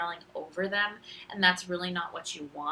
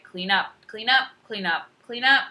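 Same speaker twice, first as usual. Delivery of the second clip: each CLEAN UP louder and more varied in tune; varying cadence; 3 semitones higher, 10 dB louder than usual. Shown as a demonstration. Teacher showing kids confusing changes, varied cadence on clean up. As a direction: repeat CLEAN UP differently each time, rising in pitch and volume. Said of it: "they can't tell the difference".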